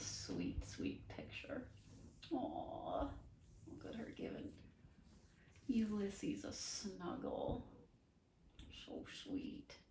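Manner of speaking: soft, hushed aw over a sweet picture; cooing. Real, not acted. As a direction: melt at the cute picture. Reader admires a picture.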